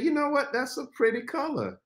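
high, half-hearted compliment; unexcited praise. Shown as a demonstration. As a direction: praise it politely but fail to sound excited. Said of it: "maybe it's the lack of excitement in my voice".

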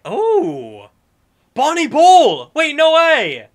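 high, loud excited shout. Real, not acted. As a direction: yell it thrilled at the find. Excited discovery.

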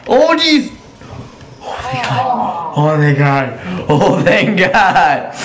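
panicked gasp easing into relief; relieved sigh after a close call; scared then relieved. Real, not acted. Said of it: "I was so scared there".

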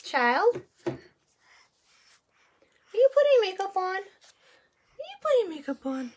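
high sing-song voice for a little one; baby talk. Acted. Talking to baby daughter.

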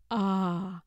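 ordinary delivery, nothing marked

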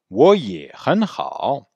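slow careful Mandarin demo; slow, all tones. Shown as a demonstration. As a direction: spell out each tone of 我也很好. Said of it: "prononcer tous les tons".

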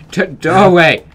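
loud, high yelp of annoyance; sudden loud cry of frustration; frustrated shout. Real, not acted. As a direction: shout at the broken doorway. The doorway he built fails.

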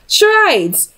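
mock-innocent answer; sly fib. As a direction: toss it off with a smirk. Will's sly deadpan lie.

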